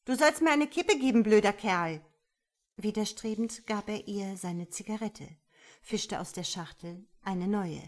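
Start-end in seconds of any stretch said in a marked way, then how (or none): 0.0-2.0 fast, loud, high demand; angry demand. A narrator's reading. Woman demanding a cigarette from man, angry. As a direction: order him with an insult. Acted quarrel over a cigarette.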